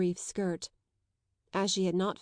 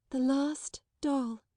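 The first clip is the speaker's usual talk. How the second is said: put-on slow, higher, mournful child voice; 6 semitones higher, slower than usual. A narrator's reading. Sarah repeating it, slow and mournful. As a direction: say it like a lost thing. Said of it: "her little mournful voice".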